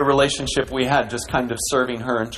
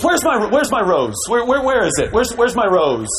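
higher, louder mock search for the rose; mimicking the minister; 9 semitones higher, 4 dB louder than usual. Pastor imitating the minister calling for his rose, mocking. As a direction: play the preacher fussing after his rose, lightly mocking. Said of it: "he goes".

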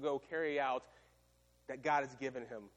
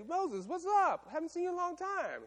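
mock breezy greeting. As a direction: greet an old friend, hamming it up. Pharaoh greets Moses.

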